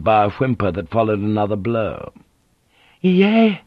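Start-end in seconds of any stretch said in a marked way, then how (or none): 3.0-3.6 high frightened cry of no; terrified cry. A narrator's reading. Frightened woman pleading with Blackthorne not to charge. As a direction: cry out in terror to stop him. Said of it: "Fujiko shook in terror".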